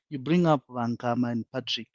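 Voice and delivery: ordinary talk, nothing marked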